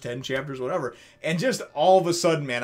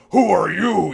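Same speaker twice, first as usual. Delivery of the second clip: louder, a touch higher mock wolf snarl; little wolf voice; 6 semitones higher, 10 dB louder, slower than usual. Wolf villain confronting Sanji, mock wolf voice. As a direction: play the wolf, startled and challenging. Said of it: "his little wolf voice".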